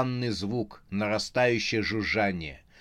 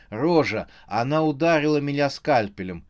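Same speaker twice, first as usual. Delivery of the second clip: usual speaking voice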